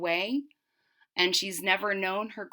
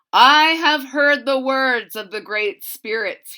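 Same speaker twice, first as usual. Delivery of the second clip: louder, grave proclamation; solemn shaman; 5 semitones higher, 13 dB louder than usual. Acted. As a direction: proclaim it to the gathered tribe. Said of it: "he said".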